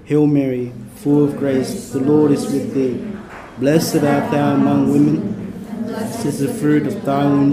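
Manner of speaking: ordinary delivery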